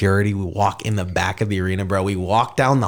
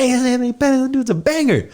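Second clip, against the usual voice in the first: high, excited gush; fanboy voice jumping high; starstruck. Retold or imitated. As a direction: squeak it out like an awed fan. Starstruck at seeing a fighter.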